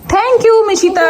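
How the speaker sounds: high praising thank you. Real, not acted. Mother thanking her daughter Mishita, high and sweet. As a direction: praise the little one up high.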